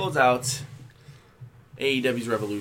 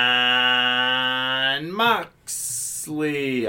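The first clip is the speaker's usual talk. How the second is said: long drawn-out announcer call; ring announcer voice; 3 dB louder, much slower than usual. Shown as a demonstration. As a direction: stretch the name like a ring announcer. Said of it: "I can't do my".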